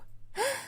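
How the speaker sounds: high gasp, breath drawn in; sharp gasp. A narrator's reading. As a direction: gasp in mock disbelief. Shocked gasp at refusal. (شهقة مفاجأة)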